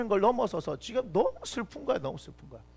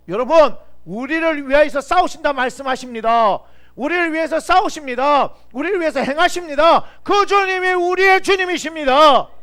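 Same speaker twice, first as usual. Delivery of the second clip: loud, higher, slow proclamation; preaching at full force; 8 semitones higher, 11 dB louder than usual. Real, not acted. Pastor declaring the Lord fights for them, full-force preaching. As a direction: build to a ringing climax.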